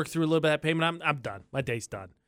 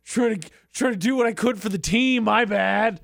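mock apology; 5 semitones higher, 3 dB louder than usual.

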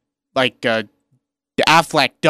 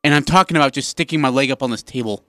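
ordinary delivery

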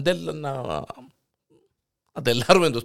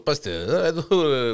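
ordinary talk, nothing marked

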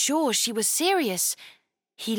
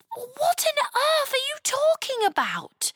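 put-on much higher girl voice, puzzled; little girl Chloe. Chloe puzzled by the knight, high girlish voice. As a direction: sound like a baffled young girl.

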